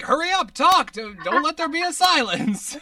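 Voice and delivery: hammed-up panicked yell to fill silence; yelling at his co-host to talk; mock frantic urging. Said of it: "waving my hand like".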